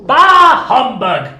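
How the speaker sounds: very loud, high, slow bark; Scrooge scoffing. Acted. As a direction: roar it with disgust. Scrooge scornful reply.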